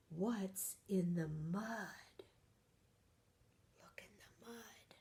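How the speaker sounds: soft whisper with a gasp; storyteller whispering in suspense; suspenseful whisper. Acted. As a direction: hush the children for the mystery.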